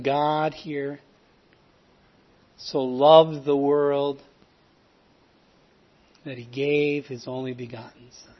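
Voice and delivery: slowed right down, weighing each word; slow scripture recital. Acted. Preacher slowly reciting the verse to a listener.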